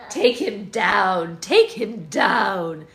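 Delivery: loud, slow battle cry; loud slow chant of take him down; shouting. A narrator's reading. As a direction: bellow it out for the crowd.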